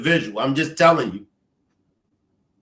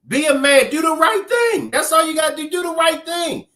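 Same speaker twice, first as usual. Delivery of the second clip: insistent, high-pitched appeal; impassioned urging; 5 semitones higher than usual. Real, not acted. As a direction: urge them hard, voice riding high. Urging the parents to act.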